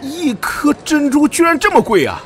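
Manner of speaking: shocked exclaim. Acted. Onlooker shocked at pearl price.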